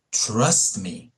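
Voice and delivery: ordinary talk, nothing marked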